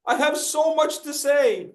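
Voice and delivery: high, excited mock speaker voice; overeager know-it-all. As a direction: ham up an eager speaker bursting to talk. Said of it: "what do I call this gesture when you're like".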